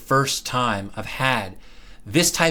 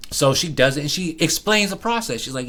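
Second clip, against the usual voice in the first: ordinary talk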